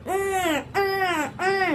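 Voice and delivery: high, soft whiny "umm" pout; mock pout sound. Mocking a girl posing for photos with a pouty hum. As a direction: ape a girl's pouting selfie noise. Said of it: "મોઢું એને આવું ચાલુ કર્યું".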